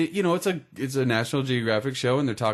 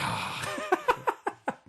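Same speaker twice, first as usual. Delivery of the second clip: long breathy exhale, no voice; emotional sigh; 6 dB quieter than usual. Acted. Co-host showing emotions over a great idea, acted sigh. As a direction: let feelings out in one long whoosh. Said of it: "my emotions just went".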